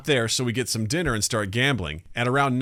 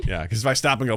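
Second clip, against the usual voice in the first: normal talking voice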